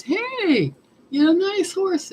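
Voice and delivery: high, mocking call from a car window; cocky young passenger. Teen passenger calling out to the girl on the horse, high and mocking. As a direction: imitate the teen leaning out to sweet-talk.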